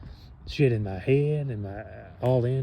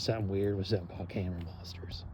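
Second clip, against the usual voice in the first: whisper; 2 semitones lower, 7 dB quieter, faster than usual. Real, not acted. Secretive aside about camera monsters.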